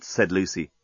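usual speaking voice

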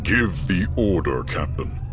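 low, slow, sinister drawl; cold rasping evil emperor. A narrator's reading. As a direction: savor the cruelty calmly. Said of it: "the Emperor".

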